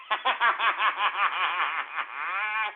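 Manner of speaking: mocking belly laugh; gloating laughter; gleeful laugh. Real, not acted. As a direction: laugh at their defeat. Gloating after taunting.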